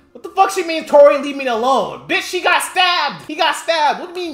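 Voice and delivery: high, loud, disbelieving yell; furious shout. Real, not acted. Streamer yelling at chat about Megan's words, furious. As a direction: explode at the statement.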